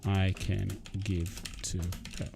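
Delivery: slow, drawn-out words while typing a message; slow dictation. Real, not acted. Streamer dictating a text to his contact, slow and drawn out. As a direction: spell it out slowly as you type.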